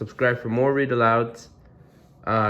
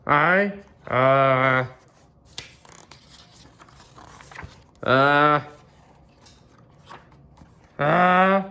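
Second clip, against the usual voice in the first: halting "uh"s climbing higher to "ah!"; sneeze build-up; 6 semitones higher, much slower than usual. Acted. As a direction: wind up toward a huge sneeze.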